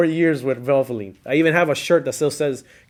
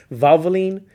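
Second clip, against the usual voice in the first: brand name laughed out, higher and louder; laughing; 5 semitones higher, 7 dB louder than usual. Real, not acted. Friend repeats the old oil brand, laughing through it.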